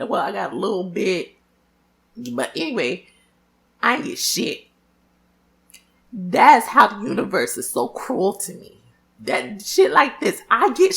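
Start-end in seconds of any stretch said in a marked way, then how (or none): none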